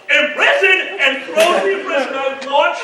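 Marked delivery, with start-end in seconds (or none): 0.1-1.0 high, loud call; hammed-up spell-casting cry; retold spell shout. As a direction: cast it with a yell. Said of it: "our friend goes".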